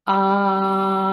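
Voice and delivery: steady held ah on breath support; sustained vowel demo; clear sustained ah. Shown as a demonstration. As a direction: hold a clean ah from the core. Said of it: "and then say".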